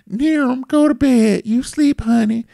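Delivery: imitating partner. Man mimicking partner sending him to bed, lightly coaxing. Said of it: "Nyambi always wanna".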